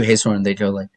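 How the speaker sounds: normal talking voice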